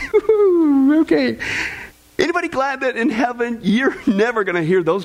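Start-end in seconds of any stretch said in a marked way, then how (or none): 0.1-1.0 loud, high-pitched exhale of relief; long falling whoop of relief; high "whew". Real, not acted. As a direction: let out a big high whew. Reacts to the joke's response.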